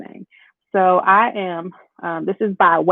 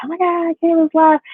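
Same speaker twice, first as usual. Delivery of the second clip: higher, mock-excited gasp at the news; excited surprise; 10 semitones higher than usual. Viewer spotting Kayla's live stream, mock excited. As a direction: play a viewer thrilled to catch her live. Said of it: "see it and be like".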